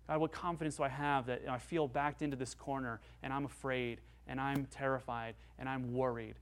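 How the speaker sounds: normal talking voice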